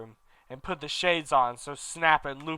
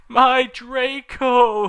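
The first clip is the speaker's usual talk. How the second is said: higher, louder and very slow moan; 10 semitones higher, 9 dB louder, much slower than usual. Acted. Vampire moaning over the lost Draco. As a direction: draw out the lament. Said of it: "he moaned".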